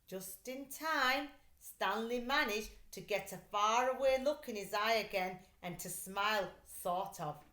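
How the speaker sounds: usual speaking voice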